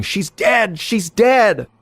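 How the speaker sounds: high-pitched narrated yell, rising in force on the repeat; acted yelling. A narrator's reading. Three girls running to a man pointing behind them, yelling. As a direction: cry out high as the alarmed girls, without full volume. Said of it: "started yelling".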